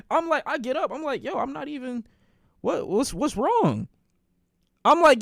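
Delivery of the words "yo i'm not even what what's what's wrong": flustered innocence. Retold or imitated. Answering his angry sisters, flustered and innocent. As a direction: stumble through it, innocently confused.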